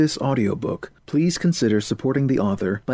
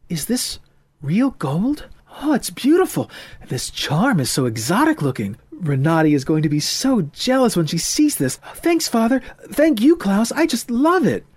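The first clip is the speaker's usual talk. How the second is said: put-on high, bright, excited young girl; delighted girl; 9 semitones higher, 4 dB louder than usual. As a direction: gush over the gift. Voices Heidi, delighted.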